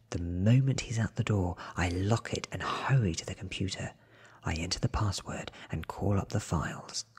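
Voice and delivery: ordinary talk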